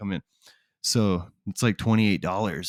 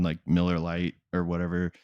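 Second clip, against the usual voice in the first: normal talking voice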